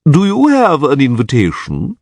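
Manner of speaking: lightly voiced pompous official, looking down on them; haughty chamberlain. A narrator's reading. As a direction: ask it with cold disdain. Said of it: "haughty expression".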